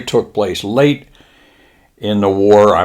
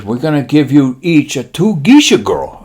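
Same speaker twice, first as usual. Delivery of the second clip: lightly voiced Japanese admiral, pitched up; slight put-on Japanese accent, higher voice; 5 semitones higher, 3 dB louder than usual. Admiral promising pilots leave, light mock-Japanese voice. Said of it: "He says".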